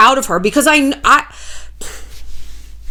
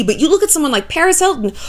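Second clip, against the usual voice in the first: ordinary talk, nothing marked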